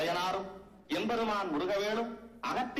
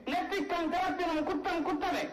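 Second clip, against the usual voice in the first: ringing defiance climbing higher; defiant declamation; 4 semitones higher than usual. Acted. Critic defies the god over a fault, defiant. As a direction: stand firm and proclaim the verdict.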